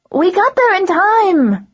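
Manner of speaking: put-on high, loud desperate teen girl; desperate cry from a teenage girl. A narrator's reading. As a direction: plead it in a high girl's voice. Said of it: "Dare protested, her voice desperate".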